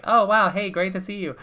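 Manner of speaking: high, bright-eyed hello; hyped greeting. Retold or imitated. Rapper greets Honer backstage, high and eager. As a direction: greet a friend with sudden eager excitement. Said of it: "he's like".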